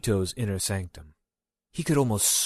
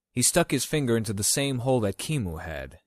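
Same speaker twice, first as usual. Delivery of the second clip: usual speaking voice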